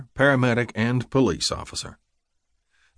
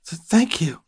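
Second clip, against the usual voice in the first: higher, halting woman's voice; finding her voice; 9 semitones higher than usual. A narrator's reading. Recovering woman thanks the stranger, haltingly finding her voice. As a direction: stammer out timid thanks. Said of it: "finally finding her voice".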